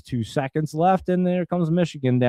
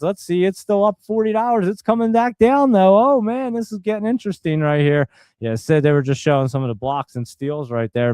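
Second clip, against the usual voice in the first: usual speaking voice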